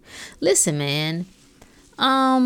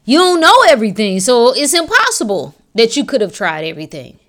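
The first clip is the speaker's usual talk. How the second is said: exasperated outburst; 3 semitones higher, 7 dB louder than usual. Real, not acted. Exasperated at people claiming everything tried.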